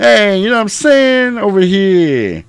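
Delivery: loud, high caricature of a New Yorker; New York accent. Acted. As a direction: lay on a thick, drawn-out New York accent. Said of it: "New York accent".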